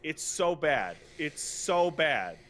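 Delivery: ordinary delivery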